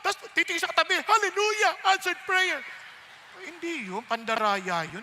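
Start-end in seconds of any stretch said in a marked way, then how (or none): 1.0-2.6 high, louder mock hallelujah; hammed-up exclamation of answered prayer; mock jubilation. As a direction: pretend praise for a lucky answer. Cheating student's acted fake praise.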